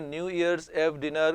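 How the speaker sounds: ordinary talk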